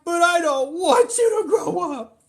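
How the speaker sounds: high, loud, half-shouted mock wail; hammed-up crying shout. Dad protesting daughter's growing up, mock crying shout. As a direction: belt the fake grief out.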